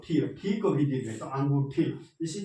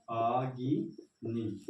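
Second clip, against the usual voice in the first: अग्नि drawn out slowly, each syllable clear; slow dictation; 2 semitones lower, much slower than usual. Shown as a demonstration. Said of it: "फिर लिखेंगे अग्नि". (धीरे-धीरे लिखवाना)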